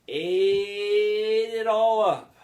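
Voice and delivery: high, loud, long drawn-out words; exaggerated slow, soaring emphasis; big story flourish. A narrator's reading. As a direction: stretch it out high and loud for the child. Storyteller dramatizes gobbling it all.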